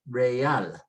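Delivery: "real" as re-AL, two syllables; "real" split in two, second part stressed; last-syllable stress. Shown as a demonstration. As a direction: slowly say "real" in Spanish, weight on AL. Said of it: "we would be pronouncing the last syllable".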